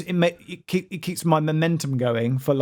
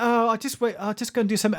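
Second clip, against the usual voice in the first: higher, airy put-off tone; lazy old self voice; 6 semitones higher, faster than usual. Retold or imitated. Speaker mocking old tea-break habit, higher lightly voiced. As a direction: mimic your old dawdling self. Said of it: "I'd just be like".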